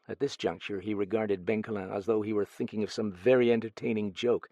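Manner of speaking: plain reading voice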